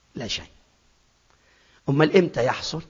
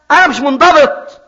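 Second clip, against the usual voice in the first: emphatic; 10 semitones higher, 8 dB louder than usual. Shown as a demonstration. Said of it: "repeated take".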